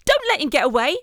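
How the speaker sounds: loud, high-pitched shout after someone fleeing; yell. A narrator's reading. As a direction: yell urgently to the gang. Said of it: "yelled one of the Rat Catchers".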